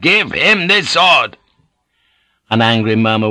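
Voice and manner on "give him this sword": high, slow, forceful shout; drawn-out commanding yell; shouted demand. A narrator's reading. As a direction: demand it with every word punched.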